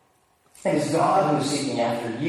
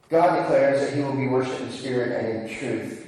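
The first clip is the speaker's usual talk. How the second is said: ordinary talk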